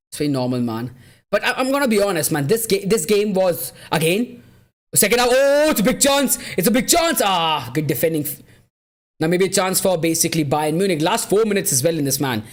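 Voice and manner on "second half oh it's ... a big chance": loud, high excited match reaction. Real, not acted. Streamer watching a big chance unfold, excited. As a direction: shout it as the chance opens up.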